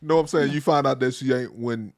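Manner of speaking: ordinary talk, nothing marked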